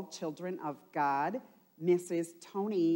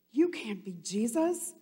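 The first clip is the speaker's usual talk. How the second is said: frightened exclaim; 7 semitones higher, 6 dB louder than usual. Acted. Peter exclaiming in fear to Jesus, high and loud. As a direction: cry out that he died. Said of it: "exclaimed Peter".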